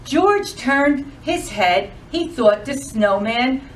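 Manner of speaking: lively, sing-song storytime voice; animated picture-book narration; reading to children. Acted. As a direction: read it like a librarian to a room of kids.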